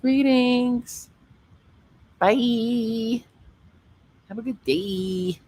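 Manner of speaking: the way she greets people; sing-song greeting. Shown as a demonstration. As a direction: greet them with a lifted, sing-song lilt. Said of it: "I just say greetings".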